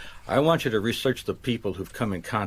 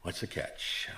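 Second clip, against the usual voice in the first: hushed, lower echo of the question; musing echo; 3 semitones lower, 11 dB quieter, slower than usual. Acted. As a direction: repeat it softly, as if to yourself. Echoes her question, musing to himself.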